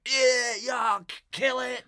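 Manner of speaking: very high, loud cries of disgust; mock horrified shriek. Stripper recoils from the customer, mock horrified shriek. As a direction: shriek in hammed-up revulsion.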